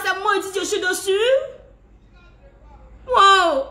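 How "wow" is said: a choked, tearful wow; wounded exclamation, loud and breaking; hurt disbelief. Real, not acted. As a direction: gasp it out, stung and near tears. Reacts to shared wedding photo.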